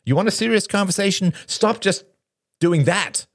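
high, insistent reproach. A narrator's reading. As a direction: push him, voice lifted high. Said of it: "Robert raised his voice".

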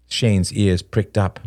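usual speaking voice

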